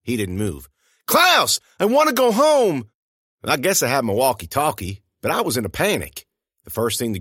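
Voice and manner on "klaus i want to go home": very high, loud panicked cry; panicked yell. A narrator's reading. Narrator panicking at Klaus, loud and high. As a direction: scream it out in panic.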